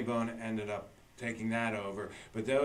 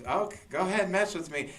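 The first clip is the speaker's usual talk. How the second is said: higher-pitched goading of hecklers; mock defiance; 8 semitones higher, 4 dB louder than usual. Retelling a heckled comic on stage, mock defiant. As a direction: imitate a rattled comic daring the crowd.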